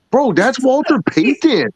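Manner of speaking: high, slow correction; retold exasperation. Man correcting his friend about the patch, exasperated.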